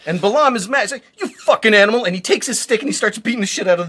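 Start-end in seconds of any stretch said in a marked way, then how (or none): 1.2-2.0 angry curse. Acted. Balaam curses his donkey.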